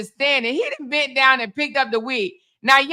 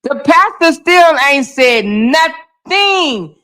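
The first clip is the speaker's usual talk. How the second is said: exasperated; 3 semitones higher, 7 dB louder, much slower than usual. Real, not acted. Exasperated at the silent pastor.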